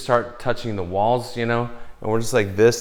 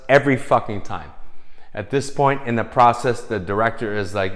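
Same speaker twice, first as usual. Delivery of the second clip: normal talking voice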